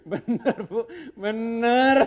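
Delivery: high, increasingly loud words through laughter; laughing insistence. Real, not acted. Speaker assuring the wives it is true, laughing. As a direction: insist it's true while cracking up.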